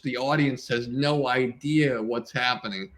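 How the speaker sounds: usual speaking voice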